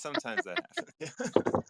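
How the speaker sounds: high playful singsong "Sometimes that happens"; high sing-song. Pretend.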